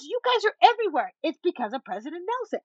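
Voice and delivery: high-pitched, gushing mimicry; imitating an over-excited stranger; animated. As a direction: exclaim it high, in wonder. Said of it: "this is how she was".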